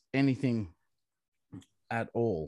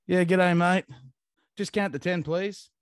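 louder, higher, a breezy Aussie cop; imitating the cop; 7 semitones higher, 4 dB louder than usual. Mocking the police officer at the roadside, brisk and chirpy. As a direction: do the laid-back cop asking politely. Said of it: "and he just goes".

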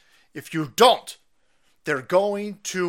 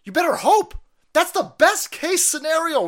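higher, louder insistence; raised voice; 9 semitones higher, 6 dB louder than usual. Real, not acted. Telling viewers the boycott is the best case, raised voice. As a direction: press the warning home. Ranting warning.

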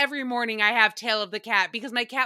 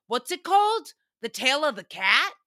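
a hint of a confused guest asking; quoting a guest; 3 semitones higher than usual. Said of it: "he goes".

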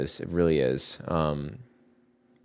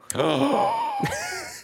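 mock shocked gasp. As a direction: gasp in exaggerated astonishment. Acting out a shocked character meeting.

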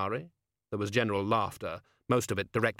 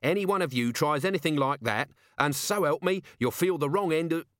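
higher, indignant threat; threatening Betty; 6 semitones higher than usual. A narrator's reading. Betty threatening anyone who harms the donkey, snarling. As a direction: warn them all off sharply.

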